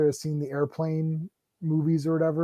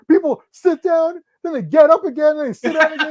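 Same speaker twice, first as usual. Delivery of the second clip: mock rant; 10 semitones higher, 7 dB louder than usual. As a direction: rant each step. Director ranting.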